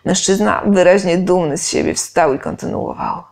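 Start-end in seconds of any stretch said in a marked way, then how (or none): none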